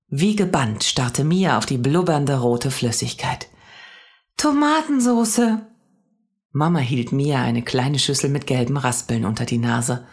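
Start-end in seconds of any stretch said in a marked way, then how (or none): 4.4-5.7 high, slow cry of wonder; thrilled little girl; delighted exclamation. A narrator's reading. Mia staring spellbound at the pot.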